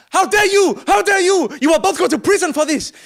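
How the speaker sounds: loud, high furious shouting; outraged driver yell. Acted. Driver berating two passengers on the bus, outraged yell.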